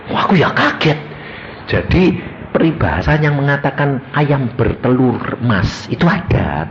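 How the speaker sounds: ordinary talk, nothing marked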